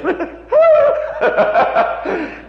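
a high burst of laughter; real amused laugh. Real, not acted. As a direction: laugh out loud at your own joke. Laughs at own mimicry.

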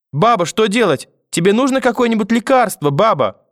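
put-on high, anxious little-boy voice; panicked child. A narrator's reading. Grandson over collapsed grandmother, frightened and pleading.

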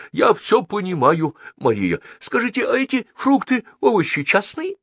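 high-pitched eager consul; excitable high-voiced foreign guest; inquisitive diplomat, politely insistent. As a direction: press on with courteous, high questions.

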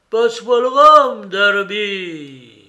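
loud, high ghostly yell with a falling tail. A narrator's reading. Unseen voice demanding rum from Darby, high wailing shout. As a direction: bellow it like a phantom calling from afar. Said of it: "Puis elle s'éleva un peu".

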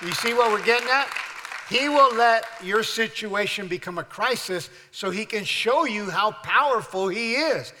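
loud, high, rousing sermon climax; full-force preaching. Acted. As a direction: preach it out to the whole church.

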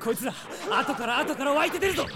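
fast, high, urgent shout; panicked rapid call; battle alarm. Acted. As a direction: warn your comrade mid-fight. Prince amid endless enemies.

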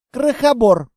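loud scornful jab; insult. Acted. Staged quarrel insult.

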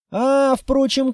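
plain reading voice

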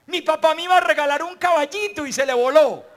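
put-on loud, high burst of childish joy; delighted boy. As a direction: shout it out like a thrilled little kid. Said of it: "ve el cagajón y dice".

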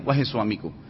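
low, soft, gentle address; calmly. Retold or imitated. Wife breaking news gently to husband, low and tender. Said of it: "dengan tenang".

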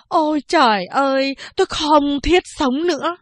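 mock wailing cry. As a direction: throw the words up to heaven in a fake lament. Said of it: "Nó gào lên".

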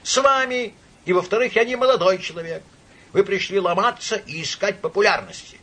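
high, loud retort; angry drunken shouting. A narrator's reading. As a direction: hurl the accusation back at him. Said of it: "прокричал он громовым голосом".

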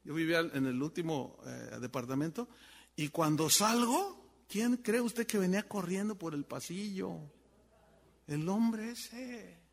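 ordinary talk, nothing marked

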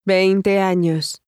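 very slow, every syllable spaced out; slow model answer. Shown as a demonstration. Teacher demonstrates the answer slowly to students. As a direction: drag it out for the learner to repeat. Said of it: "Say your line after the English prompt".

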